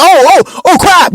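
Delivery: high, alarmed "oh crap"; startled. Retold or imitated. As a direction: caught off guard, high and quick. Car ahead brakes suddenly.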